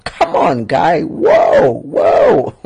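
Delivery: shouting in disbelief; loud high shouted protest; loud exclamation. Real, not acted. Reacting loudly to bad example.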